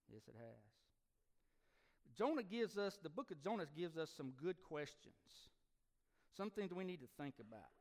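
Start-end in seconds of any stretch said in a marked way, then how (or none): 0.1-2.2 very slow, soft, drawn-out yes; a near-whisper of regret; hushed admission. Real, not acted. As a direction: let the confession sink in quietly. Repeating confession, drawn out.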